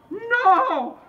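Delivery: very high, hammed-up cry of disbelief; mock horrified cry. Voter inside the booth, mock horrified high cry. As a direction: wail it in comic horror. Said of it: "I was, like, in there going".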